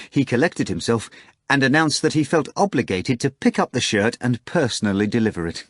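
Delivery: ordinary delivery, nothing marked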